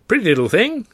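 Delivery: put-on high, loud and hammy old trouper; hearty theatrical gent. A narrator's reading. George gushing to Arthur about the contest, hearty theatrical. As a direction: play the grand showman gushing.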